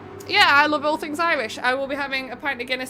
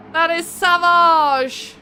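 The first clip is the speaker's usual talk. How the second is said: dramatic repeat; 4 semitones higher, 7 dB louder, much slower than usual. Real, not acted. Repeats reaction more dramatically.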